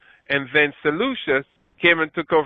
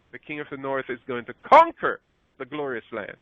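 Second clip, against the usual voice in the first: ordinary talk, nothing marked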